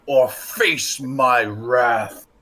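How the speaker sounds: slow menacing god voice from afar; dark lord. Acted. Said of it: "this booming voice".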